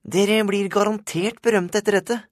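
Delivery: put-on very high, loud boy reporter voice; high-pitched reporter calling out to his subjects; eager school-paper reporter. A narrator's reading. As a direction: fire questions high and loud, like a pushy photographer.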